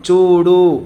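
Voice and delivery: చూడు said loud and clear, with the ఊ held long; long vowel demo. Shown as a demonstration. Teacher to pupils showing the long vowel, loud and clear. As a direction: stretch the ఊ in చూడు for the class. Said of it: "ఉచ్చారణ క్రమాన్ని గమనించాలి".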